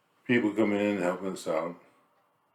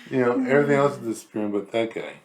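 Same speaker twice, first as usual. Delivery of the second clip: chuckling, a bit higher and louder; laughing; 5 semitones higher, 4 dB louder than usual. Real, not acted. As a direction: laugh while joking. Joking about belly, laughing.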